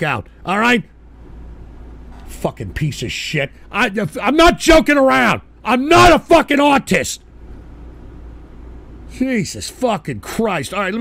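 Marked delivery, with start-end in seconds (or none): 3.7-7.2 louder, heated denial; snapping back at chat, angry; angry insistence. Real, not acted. Streamer angrily denying to chat, heated and loud.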